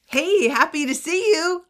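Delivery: high, loud, friendly hello; put-on perky welcome; cheerful greeting. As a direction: act out an eager, happy greeting.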